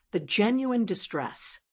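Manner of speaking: usual speaking voice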